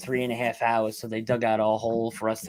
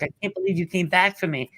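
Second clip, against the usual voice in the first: higher, lightly voiced woman in disbelief; grateful rescued woman; 7 semitones higher than usual. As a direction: mimic her amazed thanks, pitched up. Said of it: "she's like".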